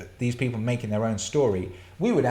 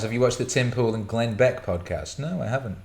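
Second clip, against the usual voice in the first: usual speaking voice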